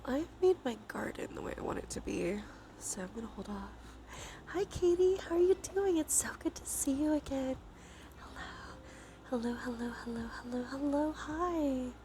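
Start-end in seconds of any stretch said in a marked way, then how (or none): none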